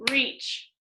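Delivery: "reach" said short, as one beat; single syllable. Shown as a demonstration. Teacher showing it has one syllable, "reach" said short. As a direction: say "reach" once, briefly, without stretching it. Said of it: "listen to this".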